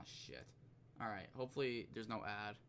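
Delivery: normal talking voice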